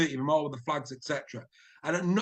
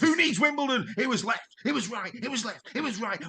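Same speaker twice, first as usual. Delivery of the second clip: big rising sports-highlights commentary, a few shouts; excitable commentary; 7 semitones higher than usual. Acted. Presenter calling the highlights reel with hammed-up excitement. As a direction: sell every score like the match of the year.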